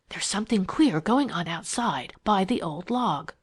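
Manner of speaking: ordinary delivery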